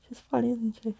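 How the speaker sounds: ordinary delivery, nothing marked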